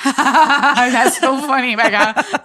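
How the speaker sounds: slow, laughing, high and loud. Retold or imitated. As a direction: laugh through the words.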